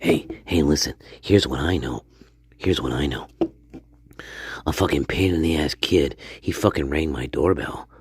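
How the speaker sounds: low, rough storyteller in character; hushed conspiratorial old man; Stanley character voice. Acted. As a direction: play the grumpy neighbour telling his tale.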